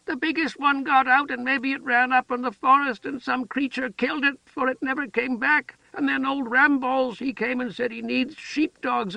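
put-on high, childlike storytelling voice; simple-minded woman chattering. A narrator's reading. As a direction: rattle on like an eager child. Heather tells the puppies' fate.

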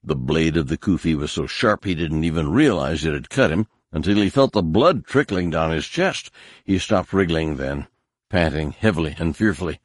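ordinary delivery, nothing marked

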